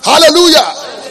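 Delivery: drawn-out, loud slow hallelujah; preacher exclaiming hallelujah. Real, not acted. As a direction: stretch out the praise slowly.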